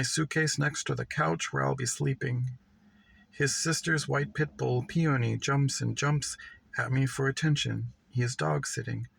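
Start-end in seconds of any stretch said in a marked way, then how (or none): none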